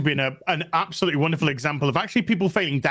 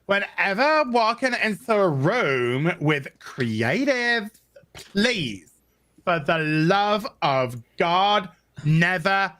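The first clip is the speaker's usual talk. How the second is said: high, slow ditzy airhead read; bimbo voice; 5 semitones higher, slower than usual. Acted. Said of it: "we gotta say it in bimbo voice".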